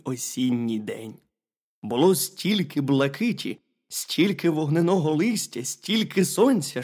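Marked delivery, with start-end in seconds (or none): none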